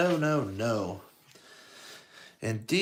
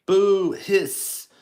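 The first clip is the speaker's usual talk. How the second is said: high, loud booing shout; 9 semitones higher, 10 dB louder than usual. A narrator's reading. Gang members booing at Basil's name, loud. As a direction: jeer it out like a rowdy gang. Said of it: "shouted the gang members".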